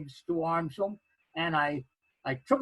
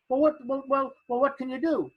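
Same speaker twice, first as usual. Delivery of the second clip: eager; 7 semitones higher, 3 dB louder than usual. Retold or imitated. Friend eager after witch reveal.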